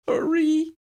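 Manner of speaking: high, pleading whimper; a scared high plea. A narrator's reading. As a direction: beg in a frightened whimper. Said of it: "Lydia whimpered".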